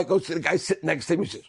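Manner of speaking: normal talking voice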